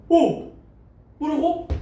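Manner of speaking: high-pitched startled double take. Acted. Man hears his donkey suddenly speak, startled. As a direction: act out stunned disbelief, pitched right up. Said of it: "그렇게 놀라는 게 있어요".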